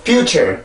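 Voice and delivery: "future" with a supported r-coloured ending; correct English pronunciation. Shown as a demonstration. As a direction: finish "future" on a clear -cher. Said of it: "이렇게 해서 발음을 해줘야 되죠".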